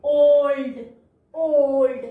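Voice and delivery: slow, loud "ओल्ड" with a stretched O; "ओल्ड" said twice, long and emphatic. Shown as a demonstration. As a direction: draw out the O in "ओल्ड". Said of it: "ओ की long sound".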